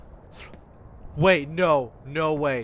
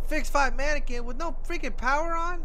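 exasperated; 11 semitones higher, 5 dB louder than usual. Real, not acted. Exasperated at task.

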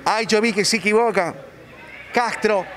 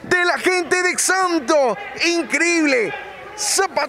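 goal commentary; 7 semitones higher, slower than usual. Real, not acted. Goal called in live commentary.

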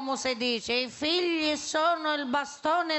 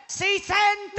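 higher, louder shout of si sente; 6 semitones higher, 4 dB louder than usual. Acted. Old woman yelling down the phone to her friend, louder shout. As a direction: yell si sente even harder down the line. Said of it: "Non gridare!".

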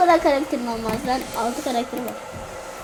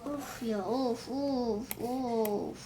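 much softer, defeated groans; deflated sighs; 3 semitones lower, 15 dB quieter, slower than usual. Real, not acted. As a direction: sigh the loss away. Deflated sighing.

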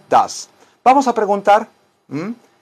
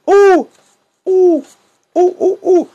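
cumbia whoops; 6 dB louder, slower than usual. Pretend. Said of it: "cumbia shout celebration".

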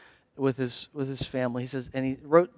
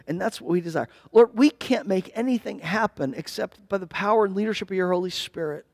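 earnest spoken prayer, rising and falling with feeling. Acted. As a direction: pray aloud for the congregation. Closing prayer over music.

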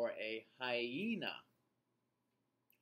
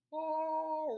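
loud high shouted ah; 7 dB louder than usual. Shown as a demonstration. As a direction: belt out a shouted ah. Shouting demo of mouths.

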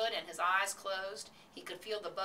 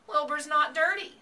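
loud, high, proud protest; indignant pride, pitched up; 10 semitones higher, 10 dB louder than usual. A narrator's reading. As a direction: stand up proudly for your pig. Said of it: "said Mister Zuckerman proudly".

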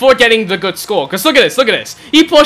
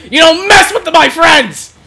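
slow, high-pitched warning yell; drawn-out defiant yell; defiant shout; 6 semitones higher, 4 dB louder, slower than usual. Real, not acted. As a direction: shout it out slowly at an opponent. Defiant outburst during the game.

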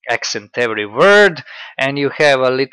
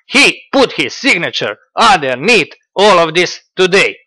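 loud, high, theatrical reading; hammed-up Dugin dictation, punctuation included; mocking Dugin quote; 5 semitones higher, 6 dB louder than usual. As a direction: proclaim it like a pompous philosopher. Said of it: "Let's see".

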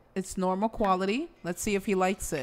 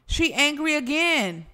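usual speaking voice

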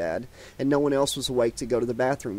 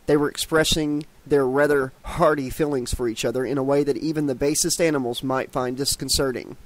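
usual speaking voice

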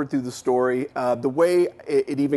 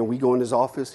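normal talking voice

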